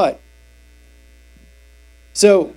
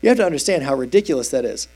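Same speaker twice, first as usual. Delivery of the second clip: normal talking voice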